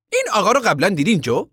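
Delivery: quick contractor question. A narrator's reading. Contractor asks the deputy about Floyd, fast and high. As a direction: point him out quickly.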